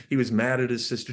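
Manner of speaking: usual speaking voice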